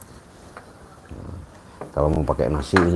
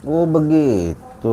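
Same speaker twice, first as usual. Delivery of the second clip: drawn-out surprise; 10 semitones higher, 4 dB louder, much slower than usual. Real, not acted. Host reacts to revelation.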